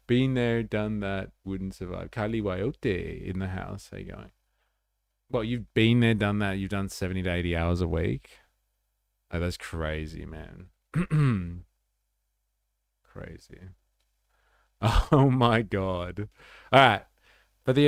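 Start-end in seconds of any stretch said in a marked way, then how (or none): none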